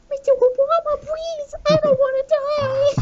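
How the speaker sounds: very high, pleading squeak; meme voice. Retold or imitated. Meme creature begging Mister Obama, very high and pleading. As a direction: squeak out a desperate plea. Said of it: "she's like".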